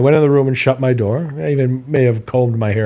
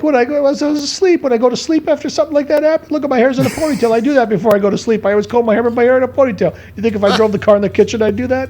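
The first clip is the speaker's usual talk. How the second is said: very high, fast mock indignation; indignant protest; 12 semitones higher, faster than usual. Son arguing his innocence to parents, high indignant protest. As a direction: rattle off the alibi in a pitched-up whine.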